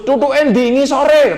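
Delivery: high, slow, teasing drawn-out question; mock search. Preacher joking about where the drum's stick is, slow high tease. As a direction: stretch it out like a cheeky joke.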